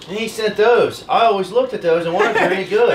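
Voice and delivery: thrilled at the gift; excited. Real, not acted. Opening a gift from a fan.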